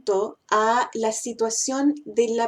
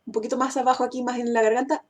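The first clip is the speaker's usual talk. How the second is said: ordinary talk, nothing marked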